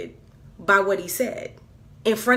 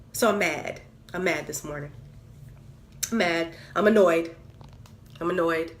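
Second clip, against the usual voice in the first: ordinary talk, nothing marked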